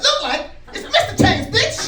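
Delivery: loud, high indignant retort; comic answering a stranger who mistook him; cocky comeback. Pretend. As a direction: own it and snap back proud. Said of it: "I'm gonna be like".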